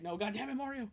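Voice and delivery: usual speaking voice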